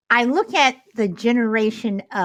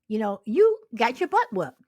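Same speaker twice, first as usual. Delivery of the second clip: ordinary talk, nothing marked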